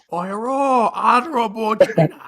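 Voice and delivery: put-on high caricatured Chinese accent; hammed-up honorific greeting in fake Chinese accent; mock Chinese accent. Host greeting honorable China, mock Chinese accent.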